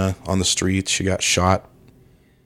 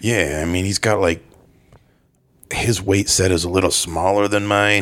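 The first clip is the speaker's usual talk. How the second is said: put-on British action-star accent; Jason Statham. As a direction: talk like a laid-back British tough guy. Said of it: "how was his accent".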